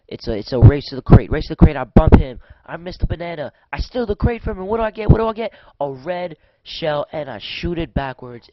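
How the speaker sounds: excited. Real, not acted. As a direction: call the race as you grab the lead. Racing to grab the crate.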